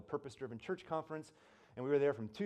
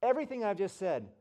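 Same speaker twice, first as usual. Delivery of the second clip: imitated preacher, raised and loud; Rick Warren; 7 semitones higher, 6 dB louder than usual. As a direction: call the room to attention from the pulpit. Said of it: "he goes".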